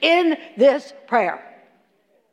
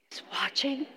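breathy hush, much softer; whisper; 4 semitones lower, 14 dB quieter than usual. Acted. Preacher whispers to congregation that God sees. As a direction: whisper it like a secret.

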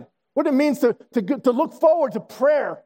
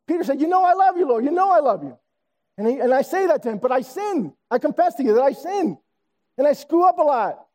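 higher, pressing confession; impassioned preaching; 6 semitones higher than usual. Acted. Pastor confessing his sin to the church, impassioned. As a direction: build the confession with fervor.

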